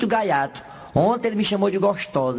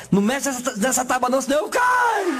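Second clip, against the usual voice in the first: panicked shout; 5 semitones higher than usual. Acted. Dead worker's panicked last words.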